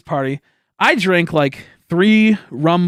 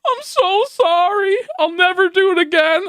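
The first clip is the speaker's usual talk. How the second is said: very high, slow whiny sorry; sarcastic mock apology; slower than usual. Mocking the apology she demanded, in a high whine. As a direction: whine it with fake contrition.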